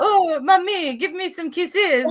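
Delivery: high, drawn-out doggy begging voice; cutesy high pet voice, laughing at the end; pet French bulldog. Pretend. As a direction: playfully voice a Frenchie wanting affection. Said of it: "He says".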